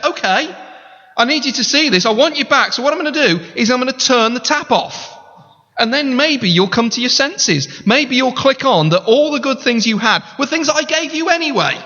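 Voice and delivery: the Lord, pleading with Israel; voicing God. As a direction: speak as God calling his bride back.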